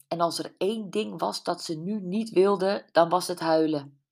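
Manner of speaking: ordinary delivery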